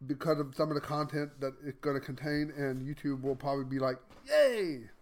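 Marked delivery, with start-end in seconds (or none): 4.3-4.9 high, louder mock "yay"; mock cheer. Imagining how YouTube will react to the content, mock cheer. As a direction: give a short pretend cheer.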